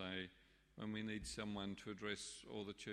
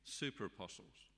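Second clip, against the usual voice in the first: cynical. Acted. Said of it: "whom Paul calls rather cynically".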